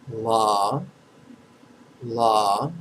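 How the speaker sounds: "la" long and isolated, lifting at the end; syllable demo. Shown as a demonstration. Teacher has students echo first syllable, pronunciation demo. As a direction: repeat "la" evenly, pausing for an echo. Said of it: "So first syllable's la".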